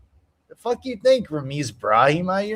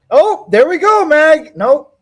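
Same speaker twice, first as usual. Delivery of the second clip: sudden high, loud cry at the fight; excited outburst; 13 semitones higher, 10 dB louder, slower than usual. Real, not acted. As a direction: blurt it out as the fight turns.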